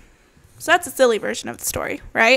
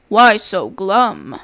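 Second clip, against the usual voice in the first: wolf; 3 semitones lower, 7 dB louder, much slower than usual. Acted. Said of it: "He says".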